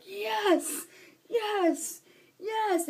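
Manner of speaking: high, drawn-out chant, falling each time; Daniel Bryan. Retold or imitated. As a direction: imitate a wrestler's triumphant catchphrase chant. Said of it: "Daniel Bryan's like".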